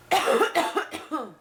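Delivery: loud hacking cough; real sick cough. Real, not acted.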